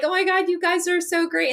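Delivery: high, gushing retold greeting; excited greeting. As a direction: gush like a fan meeting favorites. Retelling her excited greeting.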